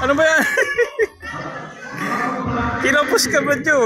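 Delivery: loud, high teasing through laughter; real amused courtside jeer; laughing heckle. Real, not acted. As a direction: burst out laughing at a missed shot. Teasing a missed free throw.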